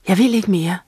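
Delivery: ordinary delivery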